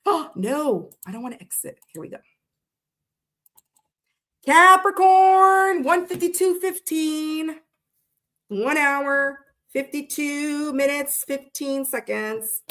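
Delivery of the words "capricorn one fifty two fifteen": high, loud, drawn-out call; loud stretched-out sign announcement; announcer call. Acted. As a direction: announce it like a game show host.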